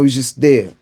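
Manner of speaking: usual speaking voice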